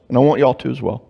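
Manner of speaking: ordinary talk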